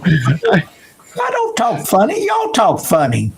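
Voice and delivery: high, slow, playful mock-offended drawl; mock indignant. [name] joking back at the host about his accent, mock-offended. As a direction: tease the host with put-on indignation.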